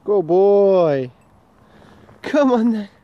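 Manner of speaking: high, loud excited praise; excited dog talk. Real, not acted.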